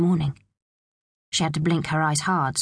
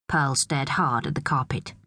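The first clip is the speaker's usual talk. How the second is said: ordinary delivery, nothing marked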